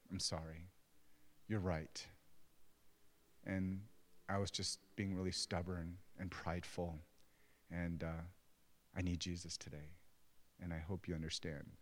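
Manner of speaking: soft, low contrite confession; hushed, subdued retold apology; humble apology. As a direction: own up gently, pausing between phrases. Retells humbly apologizing to his family.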